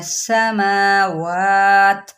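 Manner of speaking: समावात very slowly, ending stopped on त; stop on samawat. Shown as a demonstration. Teacher shows the stop on समावात, slow. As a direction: draw out and stop on त. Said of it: "अब वक्फ करेंगे".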